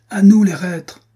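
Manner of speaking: very loud, high call for help; shouting. A narrator's reading. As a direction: bellow for the soldiers. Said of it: "crièrent-ils". (crié très fort et aigu, appel au secours)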